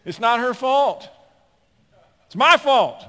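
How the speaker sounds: loud, high shouting sermon; fiery preaching. Acted. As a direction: preach it with full fire. Preaching at full force.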